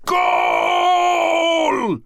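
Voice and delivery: high, full-throated scream of joy. A narrator's reading. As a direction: yell it like a goal on TV. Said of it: "a louder voice screamed".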